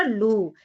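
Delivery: लू with the u drawn out; lengthened, sustained लू; long vowel. Shown as a demonstration. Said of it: "लंबे स्वर में बोला जाता है".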